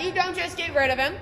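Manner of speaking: normal talking voice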